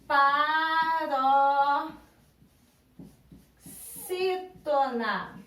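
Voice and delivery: "paroxítona" chanted very slowly, each syllable held; drawn-out syllables. Shown as a demonstration. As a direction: stretch "paroxítona" into long, level, sung-out beats. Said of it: "Penúltima sílaba".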